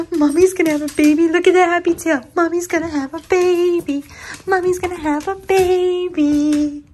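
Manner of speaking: high-pitched, gushing voice to a dog; excited pet talk. Real, not acted. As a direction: squeal it happily to your dog. Excitedly talking to her dog.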